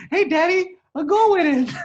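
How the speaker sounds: put-on very high, squeaky child voice; mock little girl. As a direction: put on a small kid calling Daddy. Co-host mimics the young daughter.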